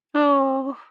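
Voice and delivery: put-on small high girlish "oh"; disbelieving young girl. A narrator's reading. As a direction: answer high, barely taking it in. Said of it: "as though she didn't believe the words".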